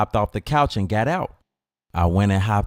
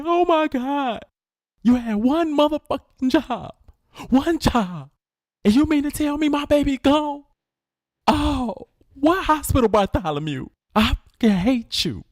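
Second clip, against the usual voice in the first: high, hammed-up wailing rage; grieving furious mama. Mama blames her son for the sister's death, high mock wailing rage. Mother voiced in story.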